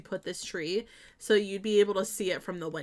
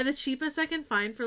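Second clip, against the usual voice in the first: ordinary talk, nothing marked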